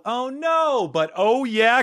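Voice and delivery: high wailed "oh, no" turning upbeat; mock dismay, then cheer. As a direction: ham up the letdown, then celebrate.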